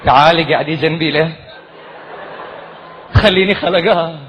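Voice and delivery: mock romantic Bahraini sweet talk; hammed-up Bahraini flirting. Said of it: "romantic conversation in Bahraini".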